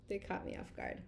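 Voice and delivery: low, soft, nearly voiceless murmur; subdued repeat. Real, not acted. Explaining her tears to viewers, quiet and subdued. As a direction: let it drop to a near whisper. Repeats it softly after laughing.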